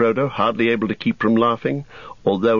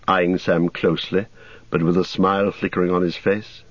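ordinary delivery

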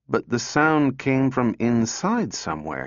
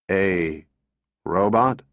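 robot voice; 3 semitones lower, slower than usual. A narrator's reading. Robot answers the girl.

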